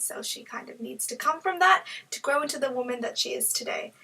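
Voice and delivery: usual speaking voice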